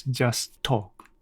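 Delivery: ordinary talk, nothing marked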